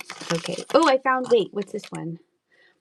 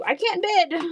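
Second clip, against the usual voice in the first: whiny bidder; 11 semitones higher than usual. Retold or imitated. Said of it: "They're like".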